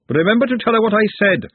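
high-pitched parting call; shouting after someone. A narrator's reading. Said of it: "Glenda shouted after her".

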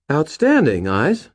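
pleased and proud of him; genuine pleasure. A narrator's reading. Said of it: "her voice brightening with genuine pleasure".

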